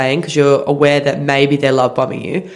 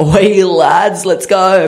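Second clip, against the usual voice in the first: hammed-up rowdy "Oi, lads" bellow; louder playful pirate shout to the lads; mock pirate call; 3 semitones higher, 3 dB louder, much slower than usual. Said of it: "I didn't like the".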